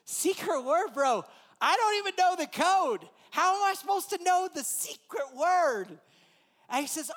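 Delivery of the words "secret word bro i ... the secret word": incredulous. Retold or imitated. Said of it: "I said".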